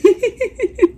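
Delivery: high, rapid staccato giggle. Real, not acted. Vlogger laughing at herself for being winded, giggling. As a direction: let out a quick run of high giggles.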